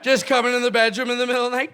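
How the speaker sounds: ordinary talk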